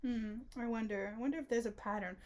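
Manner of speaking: ordinary talk, nothing marked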